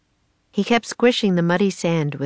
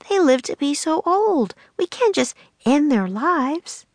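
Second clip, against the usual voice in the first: a lightly voiced girl, higher, slowing to plead; earnest young girl; 10 semitones higher than usual. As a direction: plead for the old clams' lives. Annie pleading for the clams.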